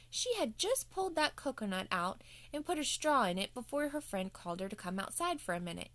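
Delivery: ordinary delivery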